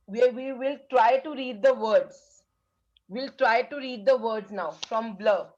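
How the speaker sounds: slow, deliberate teacher instruction; slow classroom voice. Shown as a demonstration. Teacher announcing the reading drill to young pupils, slow. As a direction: spell it out slowly for the class. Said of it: "teacher reading drill".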